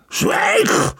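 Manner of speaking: drawn-out, very high-pitched yell; crying out. A narrator's reading. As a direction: silence him with a strained outburst. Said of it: "riep hij". (uitgeroepen bevel)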